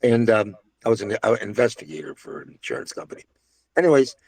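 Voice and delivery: ordinary talk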